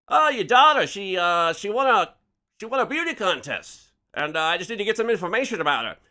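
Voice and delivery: put-on high, loud old-time Mid-Atlantic reporter patter; Mid-Atlantic accent. Reporter milking a mother for information, high loud Mid-Atlantic accent. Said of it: "a pretty good Mid-Atlantic accent".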